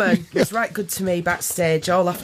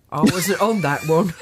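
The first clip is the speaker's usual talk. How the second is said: mock Wilko; 3 semitones lower than usual. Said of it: "you'll just turn around and say".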